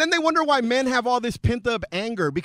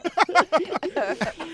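laughing; 9 semitones higher than usual. Real, not acted. Cracks up at "I love dick.

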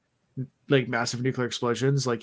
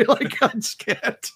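high, slow, laughing; can barely speak for laughing; 10 semitones higher, 4 dB louder, slower than usual. Real, not acted. Laughing at own story.

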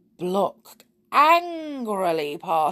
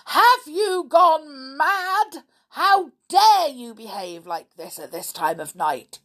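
loud, outraged scolding, stretched out at first; thundering; 8 semitones higher, 6 dB louder than usual. A narrator's reading. Stepfather scolding children for their behaviour at night, thundering. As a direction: tear into them at full voice. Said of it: "thundered Sooty's stepfather".

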